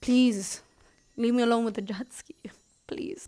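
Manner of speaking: hushed, quick whisper; a quick whispered plea, worn out. Retold or imitated. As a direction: breathe it out, begging to be left alone. Begging to be left alone.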